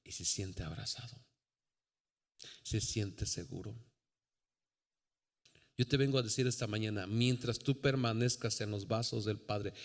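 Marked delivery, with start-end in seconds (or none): 0.1-3.8 soft, lowered, calming voice; soothing. Acted. Preacher describing a baby safe in arms, soothing. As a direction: say it softly, as over a settled baby.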